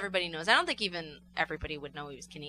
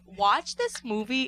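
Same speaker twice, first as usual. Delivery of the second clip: ordinary talk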